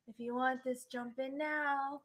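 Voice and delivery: slow, hushed creepy voice. Acted. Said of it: "that's a creepy voice".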